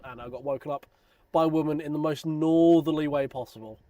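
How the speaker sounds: ordinary talk, nothing marked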